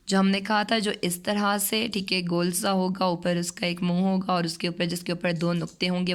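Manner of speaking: ordinary delivery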